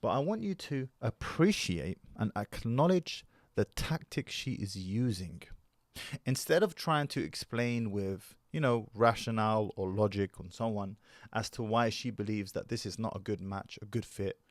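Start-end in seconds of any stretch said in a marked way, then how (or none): none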